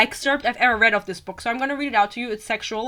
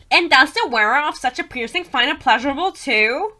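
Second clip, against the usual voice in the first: raised, high questioning voice; Poppy's voice; 5 semitones higher, 5 dB louder than usual. Acted. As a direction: play a curious heroine, higher and louder. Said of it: "I think she talks like that".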